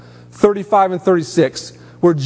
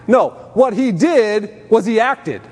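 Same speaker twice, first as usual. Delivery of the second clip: normal talking voice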